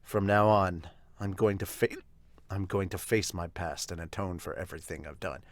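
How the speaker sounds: low, quiet, grave vow; solemn father. Acted.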